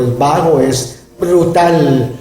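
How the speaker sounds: normal talking voice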